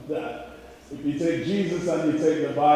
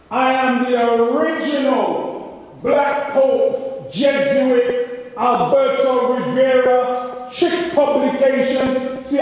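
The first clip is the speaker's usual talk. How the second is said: loud bragging; 6 semitones higher, 4 dB louder than usual. Acted. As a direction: proclaim it big, drawing words out. Mock-boasting as the original insider.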